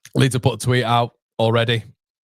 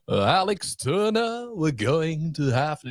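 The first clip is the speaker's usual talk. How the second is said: high, slow, drawn-out singer impression; 5 semitones higher, slower than usual. Streamer mimics the singer for viewers, impression. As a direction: stretch it out high like a crooning singer. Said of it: "rate my [name] impression".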